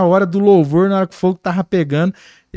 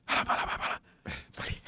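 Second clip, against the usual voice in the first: rapid whispered babble, much softer; imitação sussurrada; 17 dB quieter, faster than usual. Retold or imitated. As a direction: whisper repeated syllables quickly under your breath. Imitates tongues under the breath. (imitando línguas em sussurro)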